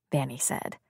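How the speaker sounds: plain reading voice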